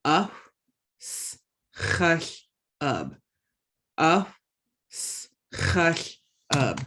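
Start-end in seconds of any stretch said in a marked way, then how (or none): 1.0-3.2 "s, chash" whispered slowly, the ch blown out hard; breathy split syllables. Shown as a demonstration. Teacher drills the syllables of allergic for students. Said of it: "make sure you're making that ch sound". 4.9-6.8 "s, chash" whispered slowly, the ch blown out hard; breathy split syllables. Shown as a demonstration. Teacher drills the syllables of allergic for students. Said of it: "make sure you're making that ch sound".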